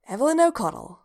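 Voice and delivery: high, proud announcement of a new name. A narrator's reading. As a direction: correct them proudly, pitch lifted. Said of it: "said Evie proudly".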